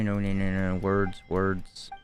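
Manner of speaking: ordinary talk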